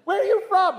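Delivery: high, loud laughing question; loud incredulous laugh. Retold or imitated. Family asking the niece in loud laughing disbelief. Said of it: "family gawking at niece".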